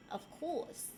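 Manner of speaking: ordinary talk, nothing marked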